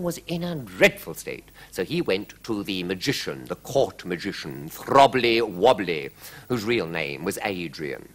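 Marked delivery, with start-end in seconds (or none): none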